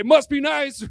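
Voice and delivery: ordinary talk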